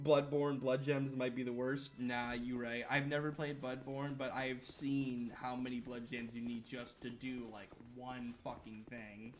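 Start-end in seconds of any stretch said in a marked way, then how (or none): none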